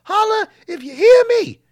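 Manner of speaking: high, loud gravelly promo shout; wrestling promo. Retold or imitated. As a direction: bellow it like Scott Steiner. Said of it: "He says".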